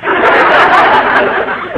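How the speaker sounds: breathy amused laugh; a short laugh at her dream. Real, not acted. Laughs at Miss Brooks' dream.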